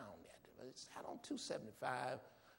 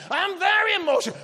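excited; 21 dB louder than usual. Acted. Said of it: "other kind of thing".

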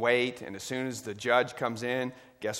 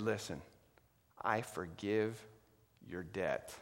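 gentle forgiveness; 4 semitones lower, 10 dB quieter than usual. Acted. King forgives kneeling servant.

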